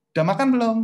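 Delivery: high, loud mock check-in; imitated questioning. Spouse asking whether partner has eaten, high loud question. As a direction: call it out as an exaggerated question.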